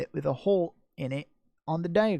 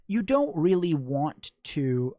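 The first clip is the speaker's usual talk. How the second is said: ordinary delivery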